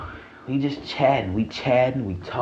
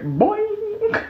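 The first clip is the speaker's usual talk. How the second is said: much higher springy boing noise; cartoon boing. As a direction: bounce it up high like a cartoon spring. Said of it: "My dick said".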